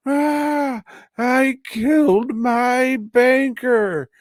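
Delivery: very high, slow, loud crazed cry; crazed wail. Retold or imitated. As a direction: wail it out like a man losing his mind. Lee confesses, head through speaker.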